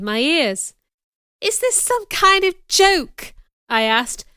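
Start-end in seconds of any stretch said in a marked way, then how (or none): 1.4-3.3 high, loud, slow disbelief; stunned, indignant question; incredulous. A narrator's reading. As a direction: draw out the question in a raised voice. Disbelieving a marriage proposal.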